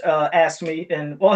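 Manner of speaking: normal talking voice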